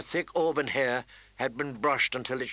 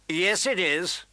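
higher, insistent contradiction; emphatic, pitched-up retort; Joffrey insisting; 5 semitones higher, slower than usual. A narrator's reading. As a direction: insist like an aggrieved boy. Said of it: "Prince Joffrey insisted".

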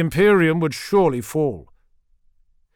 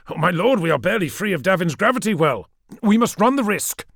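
tag urgent exchange; 6 semitones higher, 4 dB louder than usual. Acted. Officer warns lord, lord answers urgently.

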